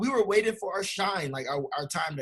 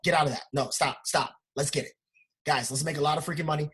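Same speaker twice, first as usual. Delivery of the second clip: usual speaking voice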